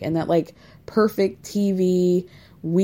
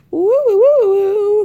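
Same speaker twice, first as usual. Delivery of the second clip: shrill hoots of disbelief, much higher and louder; loud high woo; 13 semitones higher, 8 dB louder than usual. Real, not acted. Reacting to the awkward phone call, loud high whoops. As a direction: whoop it out, stunned by the cringe.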